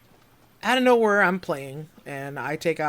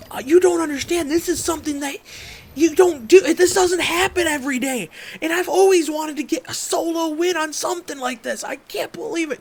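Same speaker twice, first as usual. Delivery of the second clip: excited pleading; 14 semitones higher, 7 dB louder than usual. Retold or imitated. Excitedly explaining to uncle.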